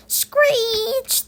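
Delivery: high, loud, stretched squeal; drawn-out screech. A narrator's reading. Narrator sounds the brakes as the driver stops, high screech. Sound word for the bus braking.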